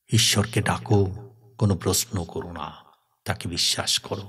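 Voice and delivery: low, soft priestly counsel; solemn counsel. Acted. Pandit advises the troubled brother, solemn and soft.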